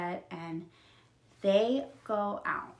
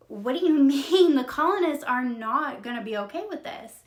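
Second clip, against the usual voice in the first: louder, higher disbelieving objection; indignant protest; 5 semitones higher, 6 dB louder than usual. Retold or imitated. Elizabeth's dad objects to the officers' plan, indignant protest. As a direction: push back in loud disbelief.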